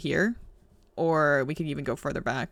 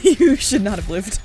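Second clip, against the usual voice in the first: louder, slow, emphatic disbelief; disbelieving exclaim; 4 semitones higher, 7 dB louder, slower than usual. Acted. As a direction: stretch it out in amazement. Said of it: "repeated with laughing disbelief".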